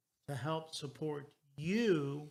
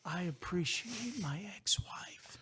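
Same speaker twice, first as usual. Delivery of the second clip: whispering; 3 semitones higher than usual. Real, not acted. Whispered confession.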